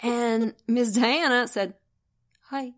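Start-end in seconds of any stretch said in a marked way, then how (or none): 2.5-2.8 half-hearted hi. Retold or imitated. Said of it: "kinda half-hearted".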